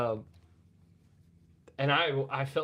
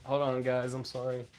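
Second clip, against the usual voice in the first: ordinary talk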